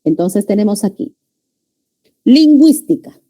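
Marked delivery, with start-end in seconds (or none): 2.2-3.2 lingüística high and loud, GÜÍS punched; tonic syllable. Shown as a demonstration. Teacher modelling lingüística for students, high and loud on GÜÍS. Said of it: "sílaba tónica".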